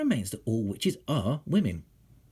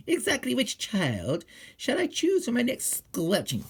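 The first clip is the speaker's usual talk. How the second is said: high, slow, relishing witch voice; gleeful witch; 10 semitones higher than usual. A narrator's reading. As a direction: savour each word like a hungry witch. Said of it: "she says to herself all day long".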